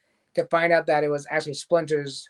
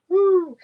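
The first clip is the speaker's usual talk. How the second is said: excited whoop; 4 dB louder than usual. Real, not acted. Excited about the episode's twist.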